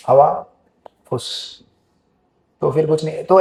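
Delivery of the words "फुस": a soft unvoiced pfff of air leaking out; deflating hiss. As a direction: let the air out like a punctured balloon. (हवा निकलने की फुस)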